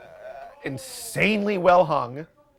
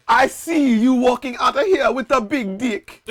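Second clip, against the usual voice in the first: high, loud mock-Jamaican prophecy; hammed-up Jamaican fortune teller; bad Jamaican accent; 10 semitones higher, 6 dB louder than usual. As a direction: proclaim a fortune in fake Jamaican. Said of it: "need to talk in a Jamaican accent".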